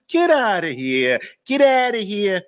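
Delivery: stretched, high Brooklyn scoff; old Brooklyn. Acted. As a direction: wave it off in broad Brooklynese. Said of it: "how we used to say it in Brooklyn".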